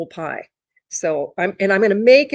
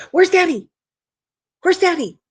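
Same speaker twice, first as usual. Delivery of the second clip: high, loud dog-teasing voice; excited pet talk; 12 semitones higher, 7 dB louder than usual. Real, not acted. Owner redirecting the dog to look for Daddy, excited. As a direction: squeal it eagerly to get the dog searching.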